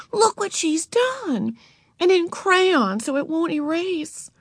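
put-on high, stretched-out girl's complaint; crying out. A narrator's reading. Beezus showing Mother the crayoned book, high outcry. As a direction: cry it out high, dragging the words. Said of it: "cried Beezus".

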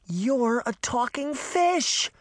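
high, drawn-out astonishment; slow, high-pitched amazed kid; amazed child. Acted. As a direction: stretch it out in wide-eyed wonder. Child amazed at talking fish.

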